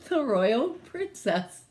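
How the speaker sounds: plain reading voice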